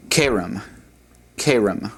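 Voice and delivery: "kerem" with the accent on KE; "kerem" said as KAY-rem, stressed up front; stress on second-last syllable. Shown as a demonstration. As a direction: hit the first syllable of "kerem", let it fall. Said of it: "second from the last syllable".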